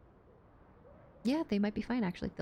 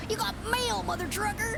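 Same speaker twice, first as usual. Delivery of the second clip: mock gruff shout; 10 semitones higher than usual. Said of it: "repeating the gruff bit".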